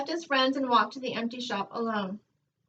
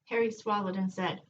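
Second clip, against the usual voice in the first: plain reading voice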